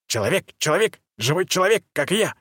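high, rising excited creature cries; gleeful, can't contain it; excited chatter. A narrator's reading. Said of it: "затараторил мой проводник".

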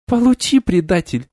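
high, spiteful jab; vicious. A narrator's reading. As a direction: spit it at the traitor. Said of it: "злобно произнесла девушка".

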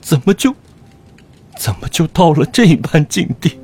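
high, rushed, grief-stricken outburst; words spill out in despair; anguished cry. Acted. Old teacher breaks down grieving. (悲痛欲绝地哭喊)

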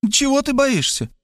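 put-on high, reproachful old mother; mother's nagging voice. A narrator's reading. Mother scolding son at the door, high and reproachful. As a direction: scold him like a fussy mother.

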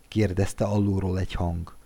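ordinary delivery, nothing marked

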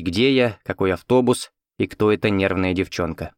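usual speaking voice

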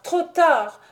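fury breaking into a scream; growing fury. A narrator's reading. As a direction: let the rage peak. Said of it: "avec une fureur croissante".

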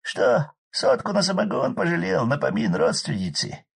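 put-on high, loud hurt drunk voice; offended grumble. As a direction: grumble it resentfully. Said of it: "с обидой проборчал".

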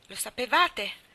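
loud cry, demanding an answer; shouted question. A narrator's reading. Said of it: "gli gridò come prima".

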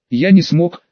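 ordinary delivery, nothing marked

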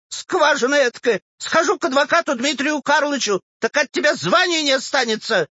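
very high, squealing tirade; shrieking old woman. A narrator's reading. Old woman threatens the clerk, shrieking. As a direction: rail at him at the top of your pitch. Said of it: "взвизгнула вдруг Щукина".

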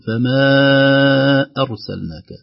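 slow chanted recitation, vowel stretched four counts; Quran recitation with long madd. Shown as a demonstration. Tajweed teacher reciting for students. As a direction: stretch the madd in "فما" over four beats. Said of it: "نمد مقدار أربع حركات".

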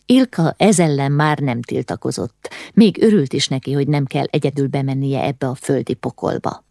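ordinary delivery, nothing marked

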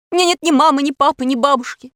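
put-on high, loud child's denial; defensive protest. A narrator's reading. Impostor Pashka denies having family to Alisa, defensive protest.

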